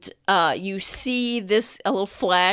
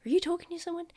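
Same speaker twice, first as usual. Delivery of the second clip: high, softened question; overheard parent voice; 10 semitones higher, 6 dB quieter, much faster than usual. Acted. Parent asking the child who's there, high voice. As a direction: call in a high voice, as from another room. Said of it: "you hear, like".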